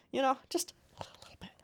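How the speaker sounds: quick conspiratorial whisper; hushed, hurried aside. Pretend. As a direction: whisper it fast, as a small admission. Confiding aside about the character.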